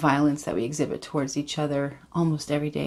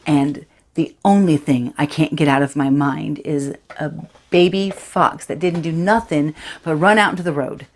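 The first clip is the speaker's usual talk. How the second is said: put-on a distraught fifteen-year-old girl, louder and rising as the memory takes over; Charity, shaken teenager; 5 dB louder than usual. Teen girl confides the fox's death to Aaron, haunted and urgent. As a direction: tell Aaron about the fox, close to breaking. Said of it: "this is Charity from Sam Holcroft's Dancing Bears".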